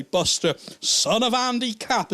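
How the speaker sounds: hammed-up catchphrase, drawn out; comic-strip catchphrase. Cartoon boy introduces himself by his catchphrase, lightly hammed up. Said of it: "And he'd say".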